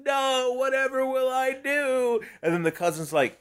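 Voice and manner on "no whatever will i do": higher, slow swooning distress; hammed-up heroine wailing at the ruins; mock tragic damsel cry; melodramatic damsel. She freaks the fuck out.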